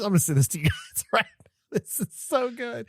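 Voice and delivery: laughing whisper. Real, not acted. Host sends the link to cohosts, whispering through laughs.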